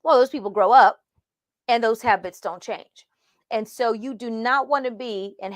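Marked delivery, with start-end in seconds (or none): none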